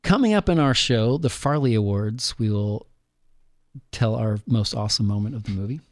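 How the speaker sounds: usual speaking voice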